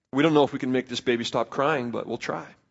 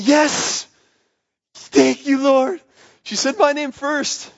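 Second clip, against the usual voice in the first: much higher and louder, a joyful shout of victory; triumphant cheer; 12 semitones higher, 6 dB louder, much slower than usual. Retold or imitated. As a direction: cheer like you just won. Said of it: "And I went".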